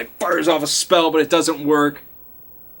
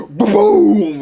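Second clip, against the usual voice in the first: 1 mock explosion; 7 semitones higher, 7 dB louder than usual. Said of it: "have it explode".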